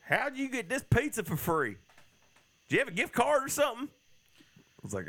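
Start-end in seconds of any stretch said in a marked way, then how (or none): 0.0-3.9 high-pitched imitation of a customer; high mocking voice. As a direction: raise the voice high, quoting someone. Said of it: "My favorite memory is".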